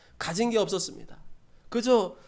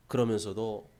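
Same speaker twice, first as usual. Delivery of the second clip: usual speaking voice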